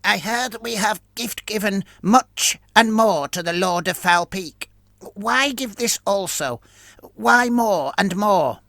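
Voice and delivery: a high, wary rat-like creature; ratman voice. Acted. Fricnar's voice in the story.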